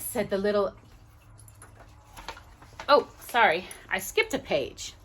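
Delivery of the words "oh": ordinary delivery, nothing marked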